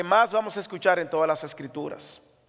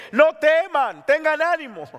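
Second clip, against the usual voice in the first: high, loud ringing command; preacher crying out the command not to fear; preaching exclamation; 9 semitones higher, 5 dB louder than usual. Acted. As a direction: cry it out to the church. Quoting scripture's command.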